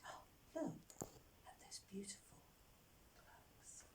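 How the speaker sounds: very soft, breath-only wonder; admiring whisper. Acted. Storyteller admiring the presents left for the elves, whispering. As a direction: whisper it, fading almost to nothing.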